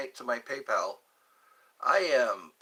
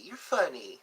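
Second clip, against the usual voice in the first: normal talking voice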